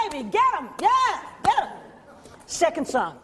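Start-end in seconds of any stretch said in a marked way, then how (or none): 0.1-1.7 mock cheering from the wings. Family cheers her on from backstage, excited. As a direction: whoop her on, rising. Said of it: "I hear Mom and the boys go".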